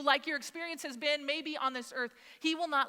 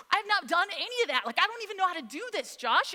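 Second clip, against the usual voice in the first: high, rushed protest; higher and louder, flustered complaint; retold panic; 6 semitones higher, 4 dB louder, faster than usual. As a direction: protest to a husband in a mild panic. Retold panicky argument with husband.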